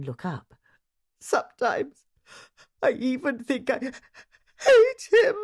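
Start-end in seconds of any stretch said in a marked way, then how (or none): 1.2-5.4 high, loud crying confession; tearful. A narrator's reading. Wife confessing hatred of her husband through tears. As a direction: choke out the bitter words. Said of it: "lifting a teary face".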